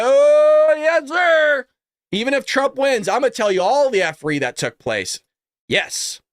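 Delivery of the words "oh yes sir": high, loud, drawn-out whoop; hyped-up shout. Real, not acted. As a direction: holler it like a hype man. Gleeful hype after making a promise.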